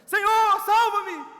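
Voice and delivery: grito alto de socorro, como quem afunda; grito desesperado. Retold or imitated. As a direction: clama a plenos pulmões por socorro. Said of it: "mas ele grita". (Pedro afundando grita a Jesus, grito de socorro)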